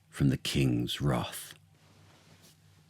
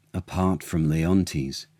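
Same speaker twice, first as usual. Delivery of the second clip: ordinary delivery, nothing marked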